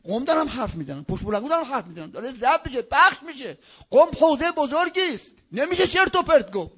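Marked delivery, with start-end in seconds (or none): none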